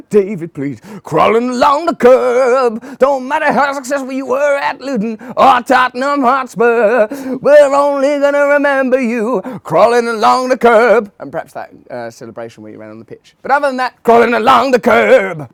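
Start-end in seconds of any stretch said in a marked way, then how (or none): 0.1-11.1 high, loud and slow jeer; mocking shout. Ranting at David Pleat, high shouted jeer. 13.4-15.5 mocking shout. Ranting at David Pleat, high shouted jeer. Rant returns.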